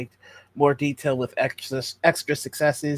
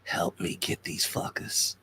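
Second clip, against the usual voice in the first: slow, hushed whisper; low, furtive whisper; conspiratorial whisper; 8 semitones lower, 8 dB quieter than usual. Acted. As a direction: whisper it like a secret plea for help. Secret plea to a captive ally.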